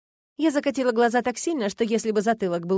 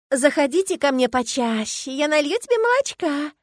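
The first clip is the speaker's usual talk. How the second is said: singsong cooing; 6 semitones higher, 4 dB louder than usual. A narrator's reading. Agata offering milk to the pet, sweet singsong. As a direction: croon an invitation to a pet. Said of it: "пропела Агата".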